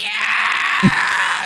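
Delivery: high, loud "Yeah!"; full-out cheering scream. Retold or imitated. Spiteful fan cheering at the man who shushed him, screaming. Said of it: "scream as loud as".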